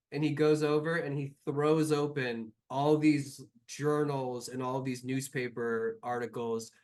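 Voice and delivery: usual speaking voice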